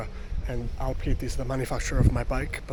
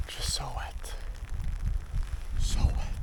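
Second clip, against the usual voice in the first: whispering; 3 semitones lower, 8 dB quieter, slower than usual. Real, not acted. Soaked cyclist muttering.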